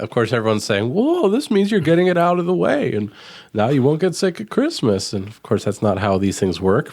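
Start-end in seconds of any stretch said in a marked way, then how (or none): 0.9-5.1 high, bright mock voice quoting everyone; lightly mocking know-it-all; mock naive optimist. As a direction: parrot the cheerful myth in a raised voice. Mocking what everyone says.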